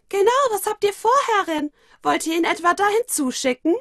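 put-on very high, fast, loud childlike voice; high rushed child voice; eager little girl echoing. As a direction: chime in excitedly. Moro twin echoing sister.

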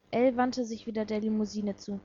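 plain reading voice

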